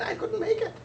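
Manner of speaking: a defeated seagull squeaking out; squeaky seagull. Acted. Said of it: "in his squeaky voice".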